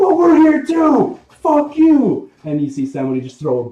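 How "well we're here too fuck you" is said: high, loud rowdy heckler; pushy spectator cursing; angry crowd member. Acted. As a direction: shove back and yell at the crowd. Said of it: "People are going".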